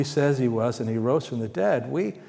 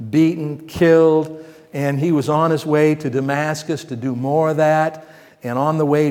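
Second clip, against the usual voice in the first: louder, driving sermon voice; preaching; 6 dB louder than usual. Acted. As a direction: build the story with full preaching force. Preaching Saul's story loudly.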